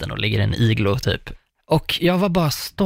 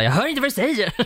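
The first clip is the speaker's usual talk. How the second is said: high-pitched mock outburst as mum; imitated mother; 11 semitones higher, faster than usual. Mum who can't hear choked-up dad, high mock exasperation. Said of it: "mamma så bara".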